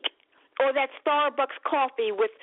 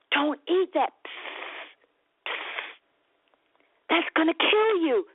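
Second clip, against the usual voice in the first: warning whisper; 4 semitones higher, slower than usual. Retold or imitated. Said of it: "Whenever you're told".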